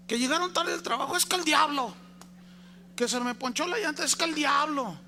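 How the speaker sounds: high, whiny complaint of a dejected devil; sulking devil. Retold or imitated. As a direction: whine like a devil blamed for everything. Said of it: "el diablo ahí sentado todo agüitado".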